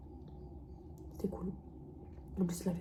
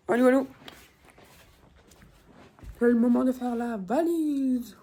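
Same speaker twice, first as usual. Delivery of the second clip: loud, slow announcing call; 4 semitones higher, 6 dB louder than usual. Real, not acted. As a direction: call out to wake the viewers. Calling loudly into camera.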